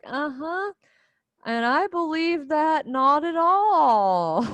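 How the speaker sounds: high, sarcastic uh-huh; mock the claim with heavy sarcasm; sarcastic disbelief. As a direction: mom not buying it, sarcastic. Mother doubting son's claim.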